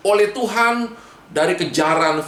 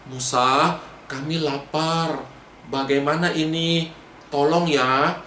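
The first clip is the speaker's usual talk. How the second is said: low, gentle request to Moses; patient; 3 semitones lower than usual. Acted. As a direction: ask calmly for help. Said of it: "Atau mereka sabar, tidak perlu marah-marah".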